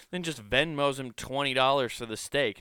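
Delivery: usual speaking voice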